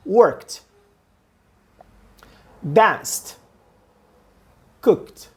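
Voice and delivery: "Worked" "Danced" "Cooked" each one quick syllable ending in T; ed as a T sound. Shown as a demonstration. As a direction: snap each word short with a final T. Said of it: "Então, bem acelerado". (pronúncia rápida com som de T no final)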